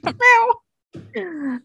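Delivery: high, soft meowing cat. Pretend. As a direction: meow like a little cat. Cat imitation.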